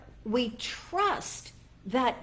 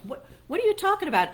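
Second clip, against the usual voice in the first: quick, higher, louder outburst of disbelief; baffled disbelief; 10 semitones higher, 4 dB louder, much faster than usual. Real, not acted. Recalling the odd interview warning, baffled and fast. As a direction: throw up your hands at the nonsense.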